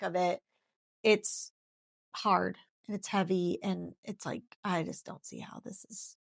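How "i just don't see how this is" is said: breathy whisper of private despair. Retold or imitated. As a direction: whisper the doubt to yourself. Inner despair voiced in hardship.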